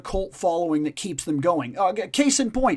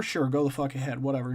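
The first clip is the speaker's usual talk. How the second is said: mock careless shrug; 6 semitones lower, 6 dB quieter, faster than usual. Voicing a publisher shrugging off requests.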